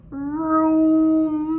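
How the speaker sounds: loud drawn-out vroom like a vacuum cleaner. Acted. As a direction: make the vacuum roar. Vacuum noise imitated.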